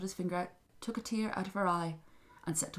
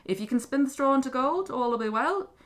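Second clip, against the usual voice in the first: lightly voiced king, raised and louder; king's bargain; 7 semitones higher, 6 dB louder than usual. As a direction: lay down terms as a ruler. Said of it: "king thought".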